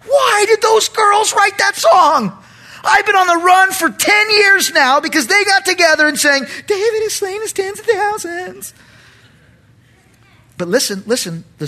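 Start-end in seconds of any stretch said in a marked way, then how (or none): 0.1-6.5 very high, loud mock wail; exasperated. Preacher as David hearing that song, exasperated. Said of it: "David heard that song and thought". 6.7-8.7 very high, mincing singsong mimicry; mocking girls. David imitating the girls' song, mocking.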